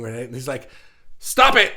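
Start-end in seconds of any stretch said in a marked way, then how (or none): 1.2-1.7 sudden loud burst of stop it; hammed-up yell; mock shout. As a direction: yell it out, laughing through. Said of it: "deadpan humor thing".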